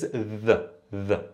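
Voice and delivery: slow, clearly voiced dental "the" for dal; original Fusha pronunciation. Shown as a demonstration. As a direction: say "the" with a voiced th, as in English. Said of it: "authentic and real and original pronunciation".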